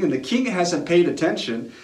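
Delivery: normal talking voice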